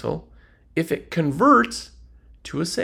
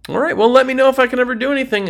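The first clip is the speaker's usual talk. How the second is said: higher, louder and fast dismissal; rushed brush-off; 8 semitones higher, 6 dB louder, much faster than usual. Acted. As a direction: hurry the customer off with a breezy high brush-off. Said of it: "the car salesman goes".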